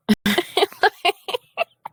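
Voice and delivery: real laugh far up in the voice; high squealing laugh. Real, not acted. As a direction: burst of shrill-free high giggling. Laughing after "not it.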